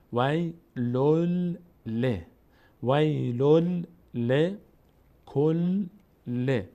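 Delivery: slow syllables وی لل ل, each l clearly doubled; doubled lam. Shown as a demonstration. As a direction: sound out کل ل with the l closing one syllable and opening the next. («لل ل» و «کل ل» شمرده، با لام مشدد)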